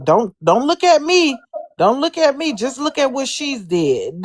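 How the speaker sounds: high mocking imitation; mocking quote. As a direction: mimic them pleading, voice up high. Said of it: "to be like".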